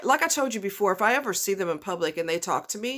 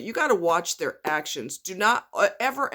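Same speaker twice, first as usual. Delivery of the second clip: normal talking voice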